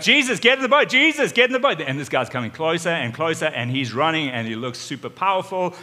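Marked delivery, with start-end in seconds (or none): 0.0-1.8 high, loud urgent calling; panicked yell. Retold or imitated. Disciples pushing the boat out, calling Jesus in panic. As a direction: yell it in alarm, pitch way up.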